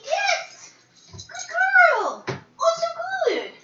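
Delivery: high squeaky praise for the dog; pet praise voice. Real, not acted. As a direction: gush over a good dog in a high voice.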